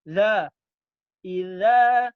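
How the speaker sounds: iza drilled loudly again and again; repeated iza. Shown as a demonstration. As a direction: drill iza with the tongue behind the teeth. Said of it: "gitu loh". (iza diulang-ulang dengan keras)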